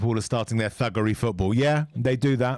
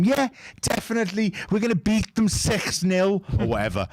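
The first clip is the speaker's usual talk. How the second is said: boastful Liverpool fan; 8 semitones higher than usual. Retold or imitated. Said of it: "They never stop backing their team".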